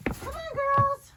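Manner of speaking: high sing-song call; calling goats. Real, not acted.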